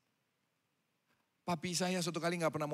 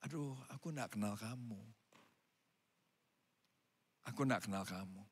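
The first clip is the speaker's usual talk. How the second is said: lower, softer sorrowful refusal; quiet rejection; 8 semitones lower, 8 dB quieter than usual. Acted. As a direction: say it gently but final. Bridegroom refusing, voiced. (penolakan pelan dan sedih)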